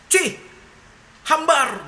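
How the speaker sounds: sharp high disgusted outburst; king shouting in disgust. Retold or imitated. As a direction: spit it out like an offended king. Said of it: "rajanya berteriak gitu kan".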